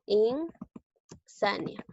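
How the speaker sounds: "Insania" very slow, in, then sania; drawn-out teacher's "Insania"; syllable by syllable. Shown as a demonstration. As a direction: pause after the first syllable of "Insania". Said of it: "Tiene tres sílabas".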